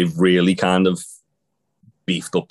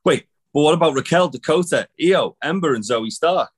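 higher, rushed imitation of the interviewer; higher mocking imitation of an interviewer cutting her off; mock eager interviewer; 8 semitones higher than usual. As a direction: parody him butting in. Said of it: "he went".

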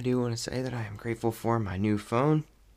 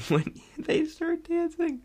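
laughing; 4 dB louder, slower than usual. Real, not acted. Laughing while recalling dance scene.

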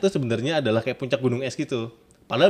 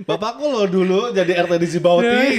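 louder, higher laughing boast; laughing mock brag; 6 semitones higher, 4 dB louder than usual. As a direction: brag grandly, cracking up. Parodying a braggart, laughing.

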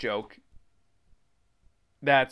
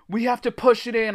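high, loud desperate cry; 10 semitones higher, 6 dB louder than usual. A narrator's reading. Narrator pleads with friends to push, crying out. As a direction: cry out the only plan. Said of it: "I cried".

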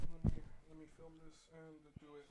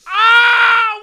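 very loud, high scream of pain; 26 dB louder than usual. Real, not acted. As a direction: scream as the wax rips. Strip ripped off.

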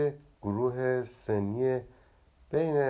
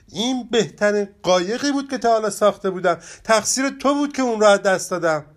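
fast, higher, louder angry reproach; upset and angry; 11 semitones higher, 9 dB louder, faster than usual. Acted. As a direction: blame your friend, hurt and cross. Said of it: "ناراحت و عصبانی برگشت".

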